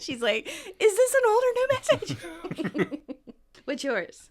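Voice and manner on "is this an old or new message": high, loud stressed-out imitation; mock panic, pitched way up with a laugh; Monica. Fan quoting Monica stressed about a message, mock-frantic. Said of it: "so stressed out about".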